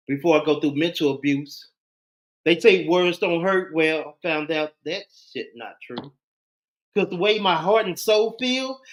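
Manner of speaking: rhythmic, emphatic poetry recital; spoken-word poem. Acted. Host giving a piece on mental abuse, spoken-word delivery.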